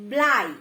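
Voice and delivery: ব্লাই ending in a clear ai glide; blai with ai. Shown as a demonstration. Teacher showing the ai ending. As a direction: stress the ai at the end of ব্লাই. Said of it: "শেষে আই যুক্ত রয়েছে".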